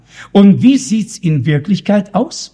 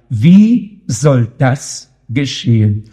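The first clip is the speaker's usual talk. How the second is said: solemn, drawn-out wondering; slow weighty question; 6 semitones lower, slower than usual. Acted. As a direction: lower and slow, pondering. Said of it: "nur noch eine Frage war da".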